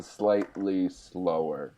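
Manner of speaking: slow, drawn-out delivery. Shown as a demonstration. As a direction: stretch the words out to mimic slowness. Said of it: "doing a French accent was speaking".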